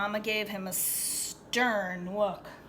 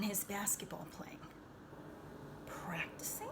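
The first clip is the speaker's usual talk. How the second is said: whisper; 9 dB quieter than usual. Acted. Question to children.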